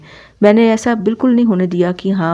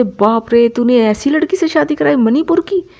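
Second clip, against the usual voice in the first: imitating the boss's stunned exclamation, higher and a bit louder; retold surprise; 6 semitones higher, 3 dB louder than usual. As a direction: play the boss gasping at the news. Boss stunned by the marriage news.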